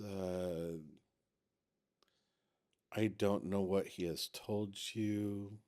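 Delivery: soft, low voice with halting pauses; subdued, careful in-character reply; hesitant, regretful character. Acted. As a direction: speak low and haltingly, choosing words carefully. Game master voices a non-player character.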